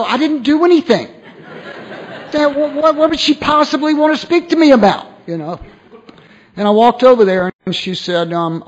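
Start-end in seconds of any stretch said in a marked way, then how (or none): 0.0-5.1 high, loud, laughing mock panic; nervous laughing babble, pitched way up; retold panic. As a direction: squeak it out like you're suddenly in trouble. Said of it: "I'm freaking out".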